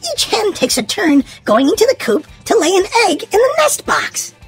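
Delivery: plain reading voice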